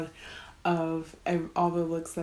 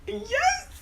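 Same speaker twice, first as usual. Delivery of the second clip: sudden high excited cry; thrilled little yelp; excited yes; 5 dB louder than usual. Real, not acted. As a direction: burst out in delight. Gets excited over the product.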